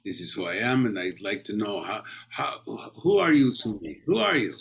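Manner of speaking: put-on Polish accent, rising into a challenge. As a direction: confront someone in a Polish accent. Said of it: "they play this man who is Polish".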